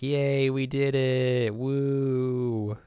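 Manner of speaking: slow, drawn-out little cheer; half-hearted pretend celebration; mock cheer. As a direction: stretch out a mild cheer. Characters celebrating in club room.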